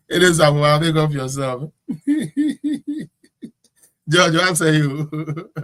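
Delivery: loud, amused, breaking into laughter; laughing. Real, not acted. Host reading a listener's comment, laughing. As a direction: talk through the laughs.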